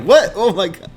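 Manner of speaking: very high, loud, mock disbelief; the group's shrill shocked reaction, hammed up. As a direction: squeal out a fake shock. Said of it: "And they're like".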